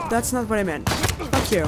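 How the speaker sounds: ordinary talk, nothing marked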